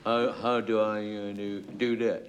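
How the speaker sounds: lightly voiced slow dim chap, hesitant; a hint of a dithering new producer; producer impression. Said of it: "a very lifelike impersonation of our new producer".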